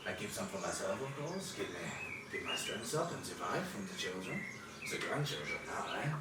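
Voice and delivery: lightly voiced old fisherman, mild and halting. Old fisherman answers travellers about his river, halting and mild. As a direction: play a gently rambling old river fisherman.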